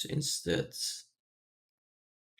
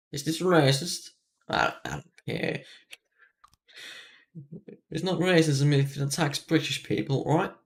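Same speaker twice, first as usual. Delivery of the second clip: lightly put-on British accent, mostly raised; 4 semitones higher than usual. Real, not acted. Gamer joking about British people, put-on British accent. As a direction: keep up the mock British accent. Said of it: "all British".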